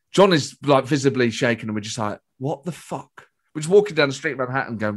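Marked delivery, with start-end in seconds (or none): 2.4-3.3 hushed, dazed disbelief; stunned. Retold or imitated. Two shaken fans walking off afterwards, stunned. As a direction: murmur it as if too shaken to speak up.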